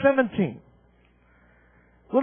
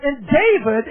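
normal talking voice

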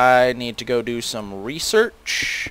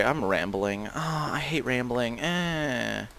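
self-reproach; 11 dB quieter, slower than usual. Real, not acted. Scolds self for rambling.

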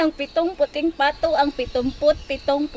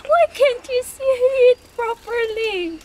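mock crying; 9 semitones higher than usual.

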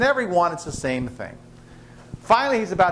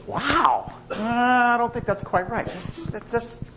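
much higher, hesitant wife voice; wife's higher voice; 7 semitones higher, slower than usual. Acted. Wife hesitantly refusing the pet shop iguana, higher voice. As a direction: play the doubtful wife, pitched well up.

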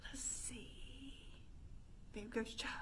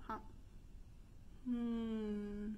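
normal talking voice

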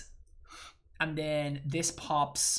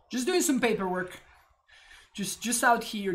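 playful; 8 semitones higher, 8 dB louder than usual. Pretend. Playful line repeated.

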